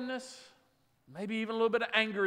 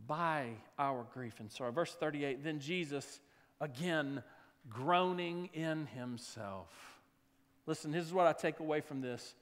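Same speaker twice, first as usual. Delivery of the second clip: soft, low, tender pastoral voice; quiet reassurance to a grieving congregation; gentle hush; 6 semitones lower, 7 dB quieter than usual. Acted. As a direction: comfort them quietly. Quiet closing reflection.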